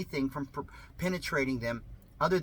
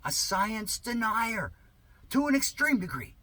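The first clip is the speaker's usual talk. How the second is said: mocking repeat; 8 semitones higher than usual. Real, not acted. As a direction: stretch it out high to mock them.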